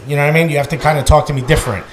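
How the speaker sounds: ordinary talk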